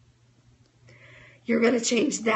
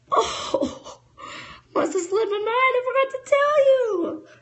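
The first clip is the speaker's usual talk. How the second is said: much higher, sing-song mock innocence; fake innocent excuse. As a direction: play the sheepish forgetful upline, hammed up. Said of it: "I'll be like".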